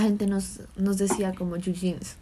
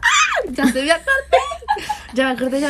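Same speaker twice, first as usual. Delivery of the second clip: excited shout; 12 semitones higher, 7 dB louder than usual. Real, not acted. Suddenly remembering, shouting.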